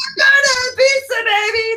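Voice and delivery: high, loud party yell; drunk shout. Retold or imitated. Drunk at a bar yelling to friends, shouting. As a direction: belt it out drunk and giddy. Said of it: "I was like".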